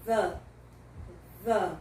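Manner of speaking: 'V' as voiced th plus short uh; 'V' as a short, closed, voiced 'thuh'; closed 'the'. Shown as a demonstration. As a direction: say 'V' as 'thuh', tongue between the teeth. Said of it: "a pronúncia mais fechada".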